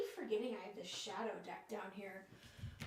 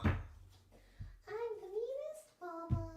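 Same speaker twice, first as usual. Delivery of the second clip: pet-talk; 9 semitones higher than usual. Real, not acted. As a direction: coo a mock apology to the pet. Mock apology to pet.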